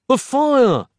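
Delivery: high, loud panicked cry; yelled. Acted. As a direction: scream the warning. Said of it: "Nick yelled".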